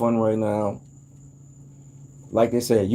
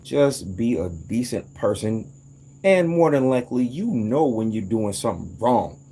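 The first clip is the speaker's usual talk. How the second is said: usual speaking voice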